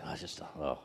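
a believer grumbling that life never goes his way; frustrated moan. Retold or imitated. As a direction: sag under it all, worn out. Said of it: "we go into frustration mode".

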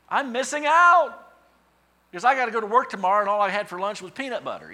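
high, loud whiny missing out; mock whine. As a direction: wail it like a jealous kid. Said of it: "And I'm like".

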